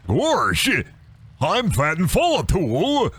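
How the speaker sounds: dopey cartoon gorsh, swooping pitch; slow, goofy cartoon drawl with big swoops. Streamer clowning as a cartoon dog, goofy voice. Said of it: "the goofy gorsh".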